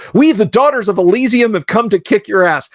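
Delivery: high-pitched spoken declaration, not sung; coworker's translation. Retold or imitated. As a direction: proclaim it like a perky coworker translating. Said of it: "she says".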